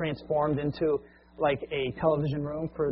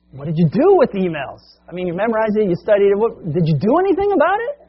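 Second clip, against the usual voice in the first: frustrated boss; 5 semitones higher, 8 dB louder than usual. Retold or imitated. Owner presses the employee.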